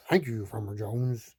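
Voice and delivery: lightly voiced low dog. A narrator's reading. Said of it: "bones to the dog".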